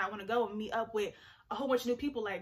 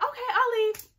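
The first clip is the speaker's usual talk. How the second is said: high, loud acted agreement; hammed-up willingness to go; mock-eager reply; 11 semitones higher, 6 dB louder than usual. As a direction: pipe up loudly, well above normal pitch. Acting out eager agreement to leave.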